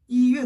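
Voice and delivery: "이" high, level and loud before "회"; first-tone 이. Shown as a demonstration. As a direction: hold "이" high, then drop "회". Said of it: "일 성으로 발음이 돼요".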